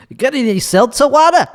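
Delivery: high, quick mock customer voice. Comic asking a shop clerk for seltzer, in a high mock voice. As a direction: squeak out the question as a fussy customer.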